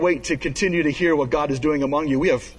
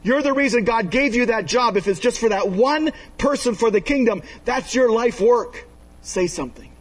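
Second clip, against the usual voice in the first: fervent preaching; 6 semitones higher than usual. Acted. Pastor urging neighbours to share faith, in full preaching voice. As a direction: press the point home like a preacher.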